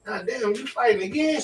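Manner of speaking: high, startled exclamation; retold shock. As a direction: squeal it out in disbelief.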